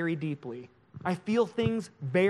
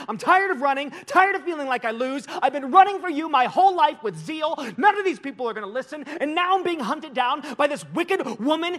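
high, loud, mounting rant at God; anguished complaint; 7 semitones higher, 4 dB louder than usual. Acted. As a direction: plead and protest in exasperated despair, pitch way up. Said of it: "Elijah says it like this".

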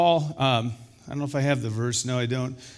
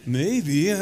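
mock hesitation; 2 semitones higher, much slower than usual. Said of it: "You guys aren't as quite as loud there".

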